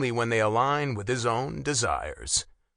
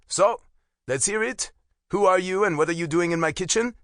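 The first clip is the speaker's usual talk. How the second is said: pitched-up, brisk interrogation; challenging, raised-voice questioning; demanding; 7 semitones higher, 4 dB louder than usual. A narrator's reading. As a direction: demand answers from an intruder. Interrogating the intruder.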